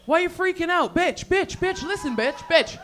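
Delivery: high-pitched, agitated badgering; acted squabble, repeated high jabs; mock exasperation. Acted quarrel escalates.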